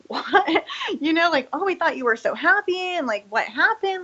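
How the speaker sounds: imitating friends' high, startled surprise; lightly voiced shocked friends; mock shocked friends. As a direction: play the friends gasping in disbelief, pitch up. Friends react to her quitting.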